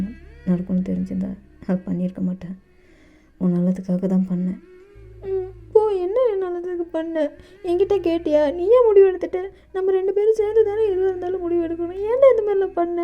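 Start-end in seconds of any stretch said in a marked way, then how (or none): 5.3-13.0 high, rapid-fire scolding with teary edge; spluttering scolding. Acted. JK scolds Dev nonstop, high and teary. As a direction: rattle off complaints like popping mustard seeds. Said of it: "கடுகு தாளிக்கிற மாதிரி தாளிக்கிறான்".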